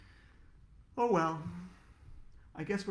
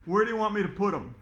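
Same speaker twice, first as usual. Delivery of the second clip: brisk delivery man; 4 semitones higher, 7 dB louder, faster than usual. Acted. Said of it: "the guy asked".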